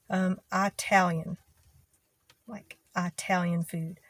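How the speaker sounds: Italian said as "Itallian" with a flat a; "Italian" with a broad, flat first a; her childhood Italian. Shown as a demonstration. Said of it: "it's a pronunciation of certain word".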